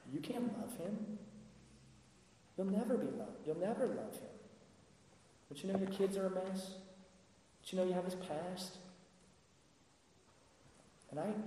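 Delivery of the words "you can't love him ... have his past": higher, softer taunting inner voice; a lightly voiced whisper of shame; accusing false voice. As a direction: play the nagging inner accuser. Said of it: "the false voice that's dictating to you".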